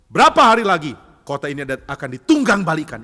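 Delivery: loud threatening proclamation; acted prophet's harsh warning; stern preaching. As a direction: thunder doom at the city. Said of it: "Yunus tetap berkhotbah dengan keras".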